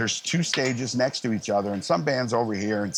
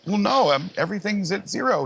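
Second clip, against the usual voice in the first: higher, louder protesting; defensive; 10 semitones higher, 3 dB louder than usual. Retold or imitated. Young engineer defends his levels to mentor, flustered protest.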